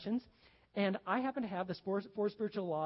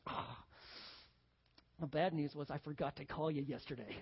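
much softer, sheepish doctor confession; sheepish quiet doctor; 4 semitones lower, 6 dB quieter than usual. Retold or imitated. Joke doctor admits his blunder to the patient, softly. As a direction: drop it low and apologetic like a guilty doctor. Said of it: "the doctor's like".

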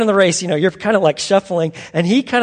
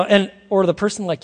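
normal talking voice

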